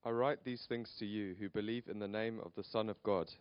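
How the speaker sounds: ordinary delivery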